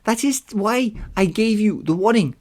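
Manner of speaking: high, put-on Nereid voice. Nereid explaining the gods' limits to a hero, high put-on voice. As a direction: pitch it far up as a sea nymph explaining. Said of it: "the Nereid told me".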